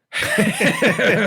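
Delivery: a sudden high, loud laugh; high squealing laugh. Real, not acted. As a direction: burst out laughing. Laughing about baby's flailing arms.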